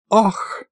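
high, languid "ah"; swooning little sigh. A narrator's reading. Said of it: "томно вздохнула Маша".

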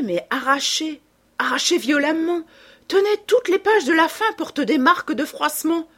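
high, loud, insistent outburst; agitated rage. A narrator's reading. Reader shows companion the violently torn pages, agitated rage. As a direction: build from dismay to high-pitched indignation. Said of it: "tout secoué de rage et de déception".

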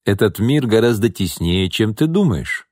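ordinary delivery

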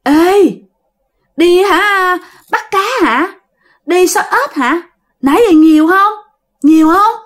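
a hint of an eerie woman calling down; ghost woman's voice. Ghost woman in the tree questioning the friend, lightly eerie. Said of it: "tiếng của một người đàn bà hỏi em".

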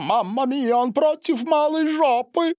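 very high, theatrical amazement; astonished exclamation. A narrator's reading. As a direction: exclaim as if hearing it for the first time. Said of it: "воскликнул Сильвио". (изумлённый высокий возглас)

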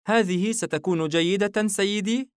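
put-on a tiny girl sure of herself; girl, cheerful. الفتاة من أعلى الرف. (صوت فتاة عالٍ وواثق)

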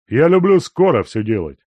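loud blunt retort; gruff old miner. A narrator's reading. Guest bluntly answering the servant, loud.

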